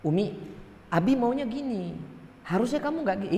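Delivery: high, insistent husband's voice; firm, not angry. Shown as a demonstration. Husband telling his wife what he wants, firm and high-pitched. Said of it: "Tegas ini bagi kami".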